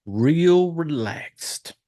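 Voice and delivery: very slow, lingering emphasis; drawn out. Real, not acted. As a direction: stretch REAL out long before RELAXED. Drawing out the key description.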